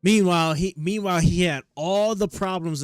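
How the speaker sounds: loud interjection. Real, not acted. Streamer cutting in on the host, a loud, mocking interjection. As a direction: butt in loudly to call out the hypocrisy.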